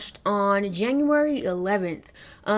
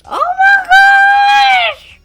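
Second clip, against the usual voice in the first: long high shriek, much louder and slow; excited shriek; 12 dB louder, much slower than usual. Real, not acted. Seeing the new shade on her hand, a long excited shriek. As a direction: squeal it out in delight.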